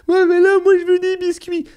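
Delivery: put-on high, loud whining kid; whiny child. Child protesting to dad about no cookies, high loud whine.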